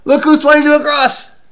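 loud, high, thrilled announcement; excited call-out. Real, not acted. Dad showing his child a clue about a friend, excited call-out.

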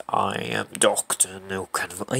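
slow, low, soft villain introduction; deep voice. Acted. Introducing the doctor by name, deep voice. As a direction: attempt a deep villain voice, quietly. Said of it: "You can't do it like that".

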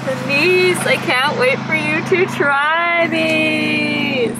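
excited. Real, not acted. Excited call to Denise.

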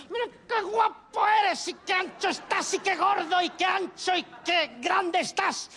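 slow, high gushing great-aunt; great-aunt's child talk. Retold or imitated. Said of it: "te habla como si tuvieras siete años".

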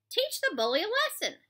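high, loud urging. Acted. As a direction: push him to fight. Pendanski urges.

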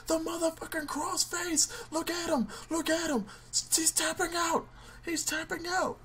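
high, hyped ringside play-by-play; announcer call. Acted. Player announcing his own win like a ring announcer. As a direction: call the finish like a wrestling announcer.

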